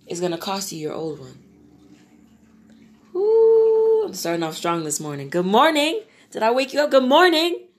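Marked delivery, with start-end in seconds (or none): 3.1-7.7 high, loud cheery wake-up call; excited greeting. Real, not acted. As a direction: greet everyone bursting with energy. Loud energetic morning greeting.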